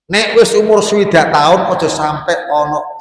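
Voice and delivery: loud, high preacher's call; preaching at full force. Acted. Preacher warning the elderly congregation, loud and high. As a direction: lift the warning to the whole hall.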